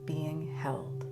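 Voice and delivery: calm, unhurried meditation voice with long pauses; soothing yoga nidra guidance, gently spaced; guided meditation. Real, not acted. Leads a guided relaxation.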